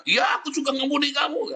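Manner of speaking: mock-shouted answer from the other side; acted reply. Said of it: "Nanti dia bilangnya".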